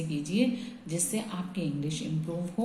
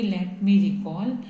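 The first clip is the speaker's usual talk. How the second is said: slow dictation; 5 dB louder, much slower than usual. Shown as a demonstration. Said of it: "तो हम इस sentence को बोलते हैं कि".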